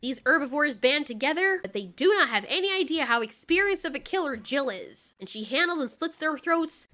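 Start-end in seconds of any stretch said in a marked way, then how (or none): none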